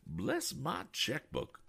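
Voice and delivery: high, slow exclaimed oath; cried out. Acted. Eccentric passenger crying out at the hay wagon, high and slow. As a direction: cry it out like a fussy old man. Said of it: "cried Mister Damon".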